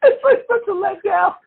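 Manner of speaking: high laughing squeal through words; giggling mock disappointment. Real, not acted. Laughing at the story.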